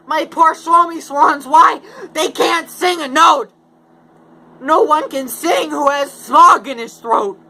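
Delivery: high, loud little-creature voice; Lorax voice. Acted. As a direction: squeak it out like the fussy Lorax. Lorax complains about smog.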